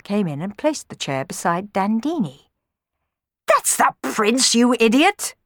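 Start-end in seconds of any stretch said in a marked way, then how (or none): 3.5-5.3 loud, high jeer; mock them as a fool; scornful, raised put-down. A narrator's reading. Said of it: "jeered Don Magnifico".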